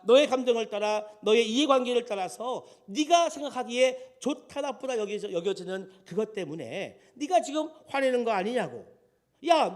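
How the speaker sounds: loud, high, insistent scolding; acted rebuke. God confronting Jonah over his selfish anger, loud acted scolding. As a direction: confront and accuse, driving each phrase home. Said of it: "그렇게 지적을 해 주신".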